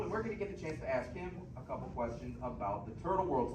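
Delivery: ordinary talk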